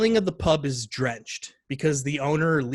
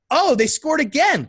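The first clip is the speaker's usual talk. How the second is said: put-on high, loud child's announcement; imitated child's excitement; excited little girl; 12 semitones higher, 7 dB louder than usual. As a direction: pipe up with news like a kid. Daughter announcing second goal.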